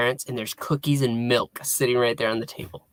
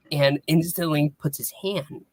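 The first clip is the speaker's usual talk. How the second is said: ordinary talk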